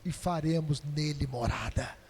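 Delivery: low, hushed promise; soft voice of Jesus. Acted. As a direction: breathe it out gently as Jesus. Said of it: "E Jesus fala assim".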